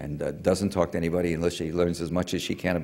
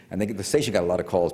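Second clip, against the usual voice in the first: ordinary talk, nothing marked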